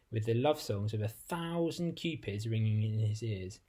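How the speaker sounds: ordinary delivery, nothing marked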